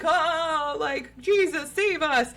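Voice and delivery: high pleading cry to Jesus; imitating the frightened mom praying aloud; panicked prayer.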